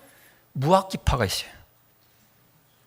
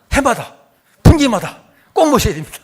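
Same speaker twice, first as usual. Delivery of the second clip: louder, higher insistent demand; mock eager demand; 4 semitones higher, 8 dB louder than usual. Women insisting the lecturer come back, loud and eager. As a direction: push it like an eager churchgoer.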